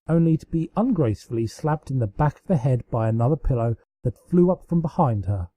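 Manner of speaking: usual speaking voice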